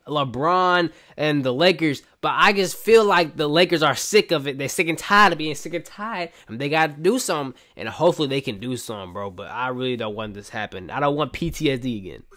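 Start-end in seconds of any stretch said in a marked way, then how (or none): none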